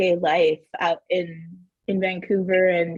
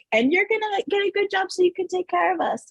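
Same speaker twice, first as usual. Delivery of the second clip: much higher pitched imitation of mom; 10 semitones higher, faster than usual. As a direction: pipe in from the back as mom. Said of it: "she's like".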